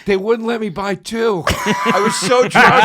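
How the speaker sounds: put-on high, slow drunk excuse. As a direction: slur it like a drunk. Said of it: "And I go".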